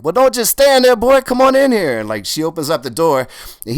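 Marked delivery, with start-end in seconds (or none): 0.0-2.0 put-on high, loud old Memphis man's call. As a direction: boom out a welcoming old bluesman's invite. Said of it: "I hear this voice like".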